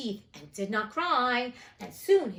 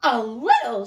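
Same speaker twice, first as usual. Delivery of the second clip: much louder, haughty and cold; with hauteur; 10 dB louder, slower than usual. A narrator's reading. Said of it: "she replied with hauteur".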